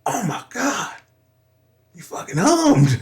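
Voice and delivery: high, amazed exclamation; imitating his lady's excited disbelief; excited amazement. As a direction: she can't believe what she heard. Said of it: "she was like".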